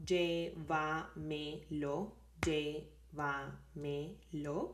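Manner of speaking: llévamelo slowly, in four separate beats; syllable by syllable. Shown as a demonstration. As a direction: stretch out each syllable: llé-va-me-lo. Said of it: "four syllables".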